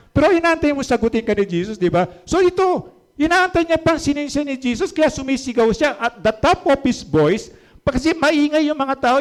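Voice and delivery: high, loud climactic preaching; preaching at full force. Acted. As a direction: build the sermon to its peak. (malakas at mataas na pangangaral)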